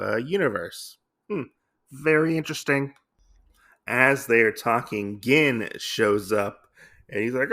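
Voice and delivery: ordinary delivery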